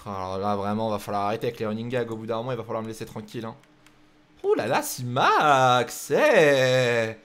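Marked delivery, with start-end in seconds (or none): 4.4-7.2 drawn-out, slow, louder amazed ouh là là; impressed ouh là là. Real, not acted. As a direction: let the ouh là là stretch out in awe. Impressed by a fast driver. (lent et ébahi)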